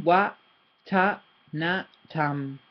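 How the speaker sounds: wa, ta, na, tam, each syllable apart; one syllable at a time. Shown as a demonstration. Teacher splitting the word into single syllables. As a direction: separate the syllables. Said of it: "every single, single one".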